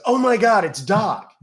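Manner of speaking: excited. Retold or imitated. Fan recognizes him excitedly.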